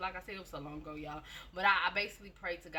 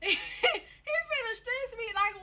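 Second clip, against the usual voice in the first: high, slow, breaking into laughter; laughing at her younger self, high and slow; laugh-crying; 5 dB louder, much slower than usual. Real, not acted. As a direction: squeal it through laughter. Laughing at herself smoking young.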